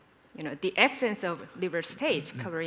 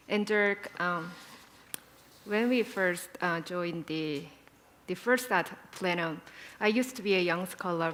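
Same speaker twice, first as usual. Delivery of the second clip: normal talking voice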